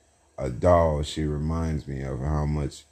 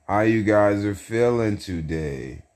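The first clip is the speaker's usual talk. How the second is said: usual speaking voice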